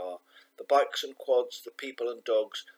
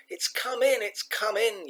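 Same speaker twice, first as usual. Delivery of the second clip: high, panicked yelling mum; 12 semitones higher, 5 dB louder than usual. A narrator's reading. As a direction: yell it in alarm, pitched way up. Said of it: "yelled her mum".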